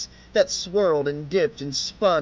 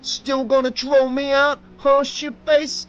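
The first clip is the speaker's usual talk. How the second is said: higher, louder jeering thug; thug mocking; 9 semitones higher, 5 dB louder than usual. A narrator's reading. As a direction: rub it in with each slap.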